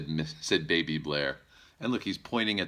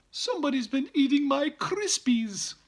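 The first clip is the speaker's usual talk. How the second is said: much higher, louder mother bear voice; 5 dB louder than usual. Acted. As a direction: squeak it out like a fussy mother bear. Missus Blair voiced in story.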